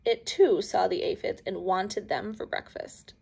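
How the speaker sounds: ordinary delivery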